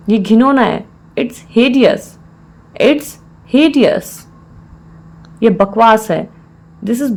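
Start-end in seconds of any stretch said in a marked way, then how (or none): 2.8-4.1 measured, with a clear mid-phrase pause; broken into parts. Shown as a demonstration. Teacher repeats the phrase for learners, in separated parts.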